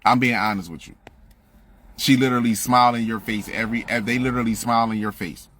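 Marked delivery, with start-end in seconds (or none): none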